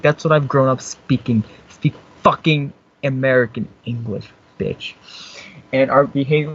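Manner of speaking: usual speaking voice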